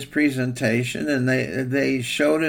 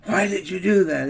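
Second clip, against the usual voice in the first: angry king; 6 semitones higher than usual. Acted. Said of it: "the king was so mad".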